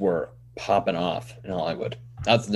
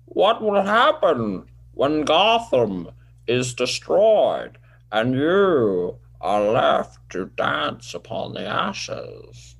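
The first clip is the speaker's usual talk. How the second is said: Bane; 3 semitones higher, slower than usual. Acted. Host as Bane threatens Batman over Gotham, grand villain impression. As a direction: stretch each phrase with swooping menace. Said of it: "now listen to my Bane".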